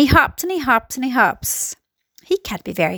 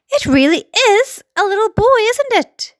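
high-pitched astonished question; amazed; 9 semitones higher, 3 dB louder than usual. A narrator's reading. Fire chief spotting a little boy, amazed. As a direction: voice climbing in disbelief.

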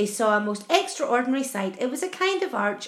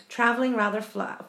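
ordinary delivery

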